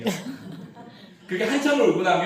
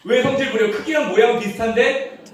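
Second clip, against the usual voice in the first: mock outrage; 7 semitones higher, 7 dB louder than usual. Baby's tantrum, voiced loudly.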